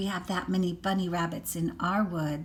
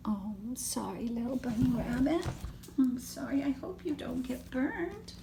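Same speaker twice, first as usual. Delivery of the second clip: sorry whisper; 4 semitones higher, 5 dB quieter than usual. Real, not acted. Comforts the toy rabbit.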